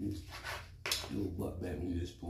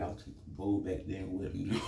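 ordinary talk

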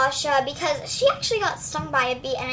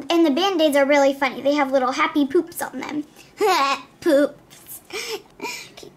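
ordinary delivery

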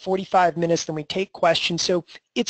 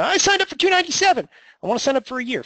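high, rushed mock customer plea; mimicking a customer; 8 semitones higher, much faster than usual. Customer asking to switch to a year, high-pitched mimicry. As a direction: put on a high-pitched customer voice, starting fast. Voices a customer wanting to upgrade.

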